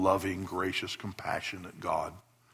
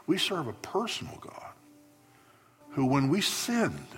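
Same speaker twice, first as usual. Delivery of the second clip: preaching at full force; 10 semitones higher than usual. Acted. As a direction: build to a ringing climax from the pulpit. Climax of sermon, rising to full force.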